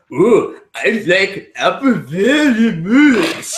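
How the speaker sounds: slow, high mock Japanese accent as Willem Dafoe; drawn-out Japanese accent impression, laughing. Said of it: "I want Willem Dafoe to do a Japanese accent".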